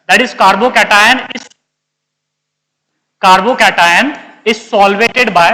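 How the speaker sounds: very slow, drawn-out words; slow dictation. Real, not acted. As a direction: dictate it slowly for note-takers. Teacher dictates notes in English. (धीमे-धीमे notes लिखवाते हुए)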